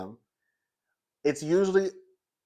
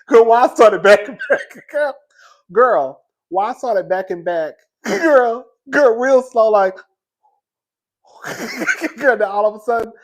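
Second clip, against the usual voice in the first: loud, high words broken by real laughter; helpless laughing; 8 semitones higher, 11 dB louder than usual. Real, not acted. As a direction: keep losing it as you tell it. Cracking up at own retreat.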